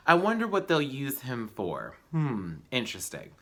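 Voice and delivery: usual speaking voice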